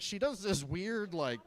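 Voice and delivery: normal talking voice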